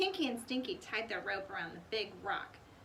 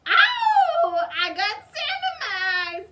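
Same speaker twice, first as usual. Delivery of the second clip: loud, much higher crying wail; whining cry; 11 semitones higher, 15 dB louder, slower than usual. A narrator's reading. Pinky whines with sand in his eyes, crying loudly. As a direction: wail in pain. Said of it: "Pinky whined".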